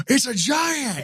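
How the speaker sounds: gasp, then high strained cry, held back in volume; high-pitched retold panic; mock scream. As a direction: imitate a panicked man shrieking, without full volume. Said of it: "he just started screaming".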